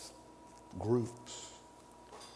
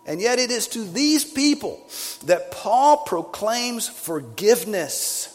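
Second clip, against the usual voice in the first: loud, high, ringing sermon climax; full-force preaching; 9 semitones higher, 8 dB louder than usual. Acted. Preacher thundering at the moral churchgoers, full-force preaching. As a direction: preach it out hard to the congregation.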